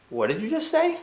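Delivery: high, soft incredulous question; stunned disbelief. Retold or imitated. Girls at the table stunned by his answer, high disbelief. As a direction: ask it as if you misheard, high and hushed.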